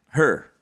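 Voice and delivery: "her" for here, in the speaker's accent. Shown as a demonstration. Speaker showing friends their accent, "her" for here. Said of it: "I say that with an accent".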